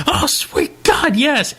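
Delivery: stretched-out high ecstatic "yes"; ecstatic exclamation. Retold or imitated. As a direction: moan out pure fan delight. Retold reaction to the trailer.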